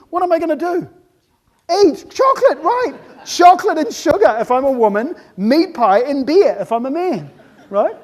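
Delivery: high, loud mock-upset outburst; hammed-up distress. Upset human reaching for chocolate, loud theatrical distress. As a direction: cry out for comfort food.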